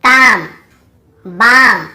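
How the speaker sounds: ताम, बाम with long "aa" straight into M; silent L. Shown as a demonstration. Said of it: "इसके पहले एल हो तो हमें नहीं पढ़ना".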